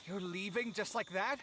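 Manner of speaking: indignant call. Acted. Roommate protesting as Alhaitham walks away, high and indignant. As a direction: call him back, outraged.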